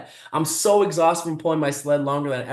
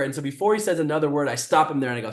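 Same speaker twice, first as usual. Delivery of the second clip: ordinary delivery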